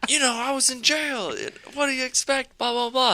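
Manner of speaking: very high, squeaky mock whine; whiny excuse. Ex-convict excusing himself to her, squeaky mock whine. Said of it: "And he's like".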